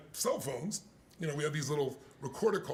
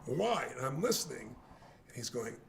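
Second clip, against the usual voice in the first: ordinary delivery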